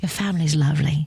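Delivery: lightly imitated British flight attendant; British accent, understated and conversational. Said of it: "Your accent is great".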